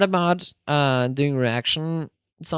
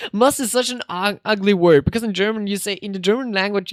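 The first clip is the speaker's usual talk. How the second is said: ordinary talk, nothing marked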